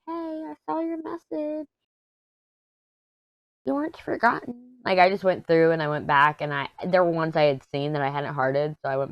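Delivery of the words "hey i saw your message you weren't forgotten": tiny reassuring voice. Pretend. Voicing the little heart to a viewer, tiny reassuring voice. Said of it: "you know, like".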